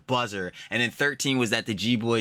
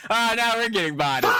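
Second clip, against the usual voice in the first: laughing mock; 12 semitones higher, 6 dB louder than usual. Mocking quote while laughing.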